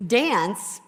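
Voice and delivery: usual speaking voice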